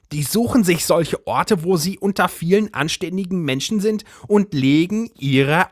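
high agitated housewife voice; Brigitte's voice. A narrator's reading. Brigitte wild theory.

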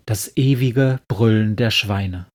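plain reading voice

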